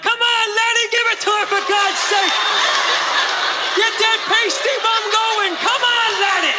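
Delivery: hint of a rowdy Irish crowd cheering; cheering ancestors. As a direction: egg him on like fans in the stands. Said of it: "my ancestors to cheer me on".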